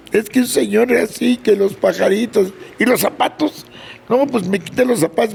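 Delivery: put-on high, tearful kid's excuse, lightly done; a boy stammering out what happened; whiny child explaining. Boy explaining the stolen shoes to his mother, high and whiny.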